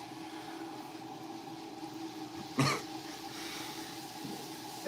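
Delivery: squeaky breathless giggle; high airy giggle; high-pitched laugh. Real, not acted. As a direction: let out a high wheezing laugh. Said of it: "high-pitched laughs".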